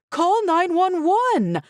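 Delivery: high, slow desperate scream. A narrator's reading. Mother screaming at husband, high and slow. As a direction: scream it out in terror. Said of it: "I screamed".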